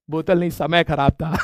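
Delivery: a hint of a calm guru pronouncement; Sadhguru. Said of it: "जैसे आप लोग सदगुरु बनते हो". (धीमे स्वर में हल्का सा गुरु वाला अंदाज़)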